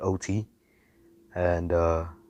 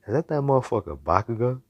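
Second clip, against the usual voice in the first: startled recognition; 5 semitones higher than usual. Acted. Hero recognizes classmate in danger.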